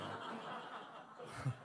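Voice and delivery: a short high laugh that breaks off; amused laugh. Real, not acted. Preacher laughing at his parents' uproar, light and amused. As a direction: laugh off the memory softly.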